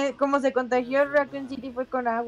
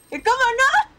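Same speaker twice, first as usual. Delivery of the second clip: panicking gamer; 12 semitones higher, 9 dB louder than usual. Real, not acted. Shocked gamer under attack.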